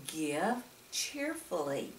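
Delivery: usual speaking voice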